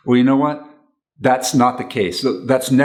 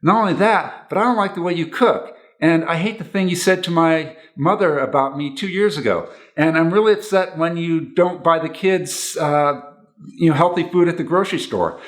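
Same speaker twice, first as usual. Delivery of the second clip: higher, rattling off grievances; piling on complaints; 7 semitones higher than usual. Retold or imitated. Partner venting a list of grievances, pitched higher. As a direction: heap complaint on complaint. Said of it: "then you go on to say".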